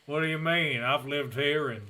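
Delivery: mock Southern accent, conversational; thick Southern drawl. Host as Jasper claiming a Washington upbringing, thick Southern drawl. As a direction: play innocent in a hammed-up Southern drawl. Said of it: "His drawl is so thick".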